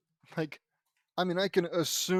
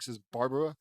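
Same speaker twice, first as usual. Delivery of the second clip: ordinary delivery, nothing marked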